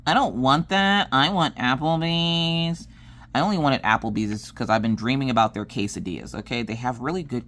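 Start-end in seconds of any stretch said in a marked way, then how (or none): 0.1-2.9 drawn-out, whiny complaint; imitating a petulant refusal; mock rude whine. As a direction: whine it slowly, stretching the last word. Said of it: "be rude and be like".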